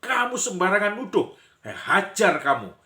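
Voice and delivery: high, loud threat in a staged fight; furious shouted comeback; acted yelling. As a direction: yell back as the wrongly accused. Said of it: "Lalu dia teriak-teriak".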